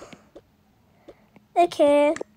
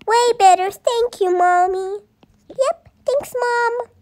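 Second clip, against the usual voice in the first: put-on child voices; 7 semitones higher, 3 dB louder than usual. Kids thanking mommy.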